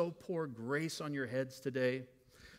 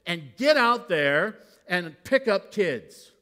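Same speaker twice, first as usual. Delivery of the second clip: forceful rebuke; 4 semitones higher, 9 dB louder, slower than usual. Retold or imitated. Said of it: "he literally says".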